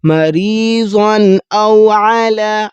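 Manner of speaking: slow melodic Quran chanting; Quran recitation. Acted. (قرآن کی تلاوت، آہستہ اور لحن کے ساتھ)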